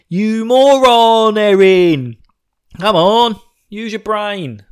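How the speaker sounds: slow, loud, high yell of frustration; exasperated yell. Real, not acted. Fan yelling at Eren for missing the obvious, loud and slow. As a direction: bellow it at a hopeless fool.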